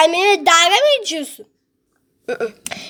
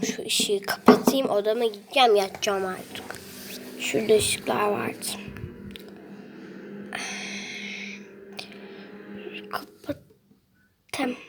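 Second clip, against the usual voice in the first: slow, unvoiced bedtime whisper; 8 semitones lower, 7 dB quieter, slower than usual. Real, not acted. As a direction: whisper so no one wakes. Whispering going to bed. (yatmadan önce fısıltıyla)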